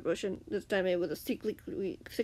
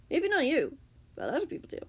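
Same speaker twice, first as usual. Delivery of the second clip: ordinary delivery, nothing marked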